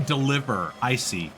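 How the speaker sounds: usual speaking voice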